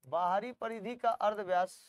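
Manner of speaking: ordinary talk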